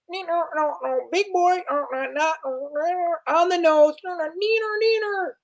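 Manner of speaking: very high squeaky taunting voice; high sing-song mock taunt; taunting robot gnome. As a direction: tease like a bratty little machine.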